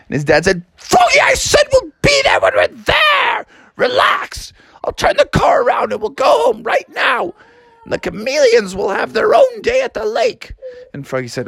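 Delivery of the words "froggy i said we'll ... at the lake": high, strained angry shouting; mad dad yelling. Acted. Dad threatens to turn the car around, furious yelling. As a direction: lose your temper and threaten. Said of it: "more and more mad".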